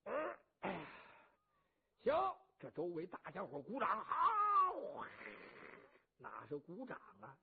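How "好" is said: a high, loud cheer from the crowd. A narrator's reading. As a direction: shout bravo like a crowd. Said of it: "这是叫道好".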